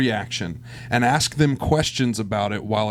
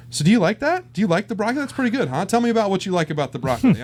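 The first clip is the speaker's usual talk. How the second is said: put-on higher, eager questions to a child; coaxing parent; 8 semitones higher, faster than usual. Parent filming the kid's broccoli reaction, coaxing and higher. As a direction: sweet-talk the kid into liking it.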